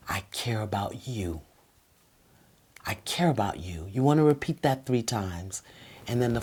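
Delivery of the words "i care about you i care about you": soft whisper, sincere; whispered I care. Shown as a demonstration. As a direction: breathe it gently to your partner. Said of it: "you wanna speak slow".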